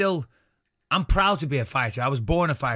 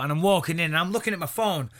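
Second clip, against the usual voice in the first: ordinary talk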